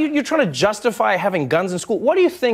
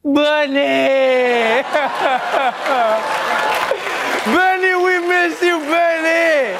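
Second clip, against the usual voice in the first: mock anguished plea; 12 semitones higher, slower than usual. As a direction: beg him to come back, slowly. Pleading to absent Bernie.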